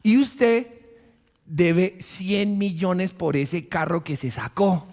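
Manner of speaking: ordinary talk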